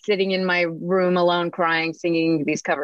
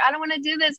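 whiny refusal; 10 semitones higher, faster than usual. Retold or imitated. Refusing friend's pushy request.

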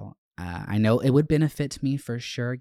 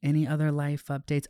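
ordinary talk

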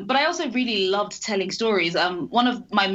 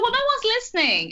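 put-on much higher, a child protesting her case; indignant little kid. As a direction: pitch it up like an affronted six-year-old. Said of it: "And I was like".

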